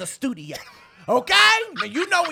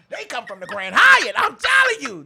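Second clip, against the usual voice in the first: excited; 8 semitones higher, 9 dB louder than usual. Real, not acted. Hyping the couches.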